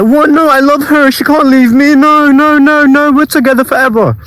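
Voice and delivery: mock the lovesick guy begging she won't leave; high panicked denial. Friend expected him to panic over his girlfriend leaving, high frantic pleading. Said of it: "Expecting me to be like".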